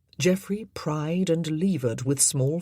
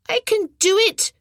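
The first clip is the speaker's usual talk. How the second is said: put-on stubborn boy; 8 dB louder than usual. A narrator's reading. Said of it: "he answered".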